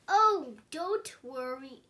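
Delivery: ordinary delivery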